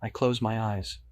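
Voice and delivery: ordinary delivery, nothing marked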